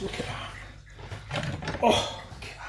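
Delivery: slow, breathy whisper of admiration; awed whisper. Real, not acted. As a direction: whisper it like you can't believe it. Admiring the food, hushed.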